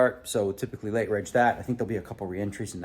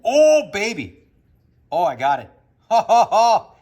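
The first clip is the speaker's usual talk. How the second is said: excited chess win; 7 semitones higher, 11 dB louder than usual. Real, not acted. Finds winning chess move.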